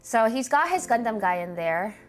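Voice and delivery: normal talking voice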